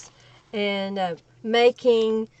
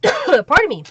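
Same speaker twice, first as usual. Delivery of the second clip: just after clearing the throat; louder, jumpy pitch after a cough; choked up; 3 semitones higher, 5 dB louder than usual. Real, not acted. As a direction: excuse yourself straight out of a cough. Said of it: "I got choked up there".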